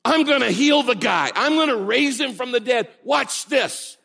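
high, loud boastful proclamation; mock show-off announcement. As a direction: brag about the miracle before it happens. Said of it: "He doesn't say".

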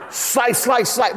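high, rapid chopping words; playful high-pitched slicing; mock slashing attack. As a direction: act out a martial-arts blade flurry. Miming a slashing tongue.

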